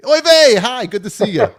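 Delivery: loud, high, gushing hello; over-bright greeting. Pretend. Host welcoming back the co-host he gossiped about, over-bright greeting. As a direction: burst out with an innocent welcome.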